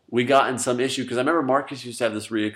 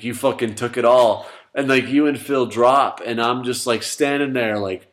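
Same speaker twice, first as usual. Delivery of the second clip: ordinary talk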